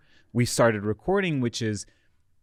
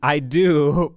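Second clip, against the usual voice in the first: usual speaking voice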